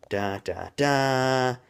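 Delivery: spoken dun-dun-dun, building to the last; dramatic music sting. Retold or imitated. Reviewer marking the father reveal, mock dramatic sting. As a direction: imitate a suspense sting after a big reveal.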